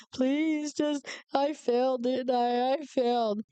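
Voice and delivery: high, pleading, tearful voice; retold crying. Pleading tearfully with the examiner after stalling, high and tearful. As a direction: beg through tears like you're sure you failed. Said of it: "And I was crying".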